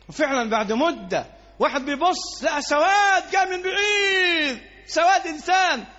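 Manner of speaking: impassioned preaching. Acted. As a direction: build the story to a passionate peak. Storytelling climax, shouted. (الخطيب يروي قصة أبي طالب بحماس وصوت مرتفع)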